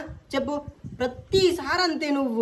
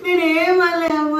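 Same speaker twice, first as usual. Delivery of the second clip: sobbing, a little higher and louder; crying; 4 semitones higher, 4 dB louder than usual. Acted. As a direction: cry it out to mum. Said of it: "ఏడవకు నువ్వు".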